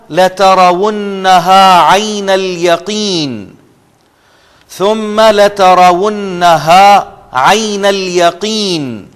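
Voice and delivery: Quranic recitation. Shown as a demonstration. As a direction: stretch each word like tajweed. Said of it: "سا کے نیچے line لگا لیں".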